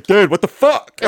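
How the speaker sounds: mock startled outburst; Andrew. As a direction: burst out like your odd-talking buddy. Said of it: "he said it in the way".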